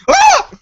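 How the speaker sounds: high, loud burned yelp; pained yelp. Acted. Jovel yelps at the flamethrower, loud high cry. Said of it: "and Jovel goes".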